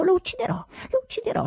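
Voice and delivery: a man losing his grip, shrieking the same threat; frenzied shriek. A narrator's reading. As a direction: scream it out of control, pitch soaring. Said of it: "strillavo io".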